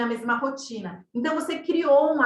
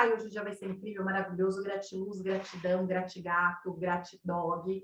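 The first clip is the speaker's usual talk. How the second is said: much softer, lower mocking gush; mock dreamy positivity; 5 semitones lower, 8 dB quieter than usual. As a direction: parody an empty positive thinker under the breath.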